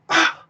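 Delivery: a sudden high startled "Ah". Retold or imitated. Woman in the dark theatre, startled high ah. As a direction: yelp a surprised "Ah" up high. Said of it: "like that".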